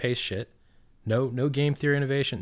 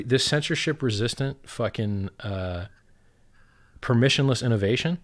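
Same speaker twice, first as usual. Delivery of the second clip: ordinary delivery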